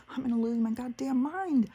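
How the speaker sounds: high, strained cry of disbelief; exasperated outburst. Retold or imitated. Grandmother hears of the hot-zone trip, exasperated. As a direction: pitch shoots up as if about to lose it.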